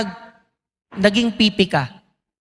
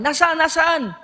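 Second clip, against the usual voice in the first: anxious neighbors; 8 semitones higher than usual. Retold or imitated. Neighbors rushing asking where.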